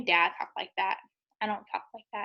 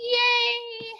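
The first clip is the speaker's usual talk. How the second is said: a high, loud cheer; teacher cheering for the kids; 12 semitones higher, 6 dB louder than usual. Acted. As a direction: celebrate with a bright yay. Cheer after the sung line.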